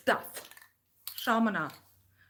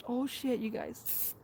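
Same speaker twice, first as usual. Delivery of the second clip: ordinary talk, nothing marked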